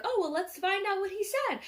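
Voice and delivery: high-pitched mock wonder; playing along. Father to little girl before opening the card, playful wonder. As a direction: act out a parent feigning curiosity for a child.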